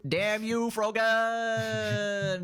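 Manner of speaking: high, drawn-out theatrical cry; mock dramatic curse. Co-host cursing the donor in play, high drawn-out cry. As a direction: wail it like someone floating off into space.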